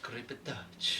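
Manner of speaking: drawn-out creepy spell name. Pretend. Player announces his spell to the table, creepily. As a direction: say it creepily, hanging on the last word. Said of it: "You gotta say it like that".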